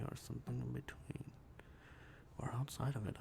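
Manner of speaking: soft whispered aside. Pretend. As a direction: breathe it like a secret.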